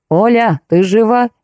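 understated narrator's shout; acted call. A narrator's reading. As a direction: call up to someone unseen. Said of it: "и крикнул".

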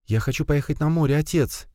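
put-on high, light child pleading; a little child begging father; child's voice. A narrator's reading. Child begging father for the sea, high and light. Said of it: "послышался детский голос".

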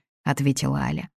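ordinary delivery

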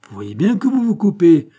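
high, loud gotcha outburst; acted scolding cry; triumphant accusation. A narrator's reading. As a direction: pounce on the slip loudly. Aunt catches nephew out.

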